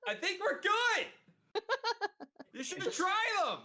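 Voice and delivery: high-pitched, drawn-out shout; roleplayed shouting. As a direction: keep shouting with no volume control. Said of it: "you are shouting and cannot control your volume".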